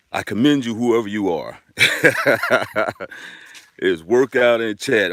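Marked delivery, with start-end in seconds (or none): 1.8-3.3 real hearty chuckle; amused laugh. Real, not acted. Streamer amused by the lone early viewer, hearty chuckle. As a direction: laugh it off in a run of bursts.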